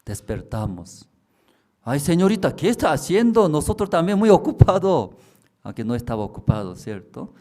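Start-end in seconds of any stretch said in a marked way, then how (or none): 1.8-5.2 put-on high, loud flustered young woman; hammed-up busy excuse; a woman brushing off visitors, high and loud; young woman's excuse.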